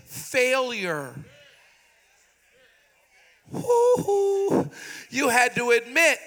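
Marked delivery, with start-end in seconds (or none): 3.5-4.7 high, drawn-out woo-hoo; high whoop. Real, not acted. Preacher reacting to admitting failure, high whoop.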